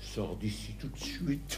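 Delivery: ordre très bas, lent et étouffé; colère contenue. Acted. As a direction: menacer tout bas, en détachant lentement. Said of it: "il faut pas te fâcher comme ça". (fâché, glacial, à voix basse)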